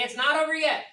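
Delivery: preacher's call to the room; call and response. Shown as a demonstration. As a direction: call it out strong.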